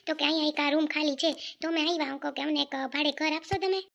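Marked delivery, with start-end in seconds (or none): none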